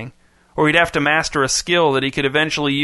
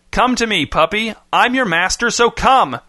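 high, insistent calling; mild shout; 7 semitones higher than usual. A narrator's reading. Master calling his puppy from the woods, high mild shout. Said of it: "stopped to shout again".

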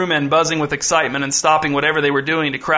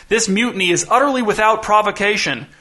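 higher-pitched, indignant outcry; screamed protest; 6 semitones higher than usual. A narrator's reading. Bound captain protests the mutiny to his crew, mild scream. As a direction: protest loftily with a raised voice. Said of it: "screamed Captain Burkess".

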